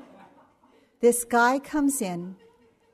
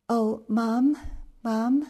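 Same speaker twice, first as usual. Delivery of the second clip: put-on higher, louder calling out in an Indian accent; 3 semitones higher, 3 dB louder, slower than usual. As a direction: mimic a mild Indian accent, calling Mom. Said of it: "in his kind of Indian accent".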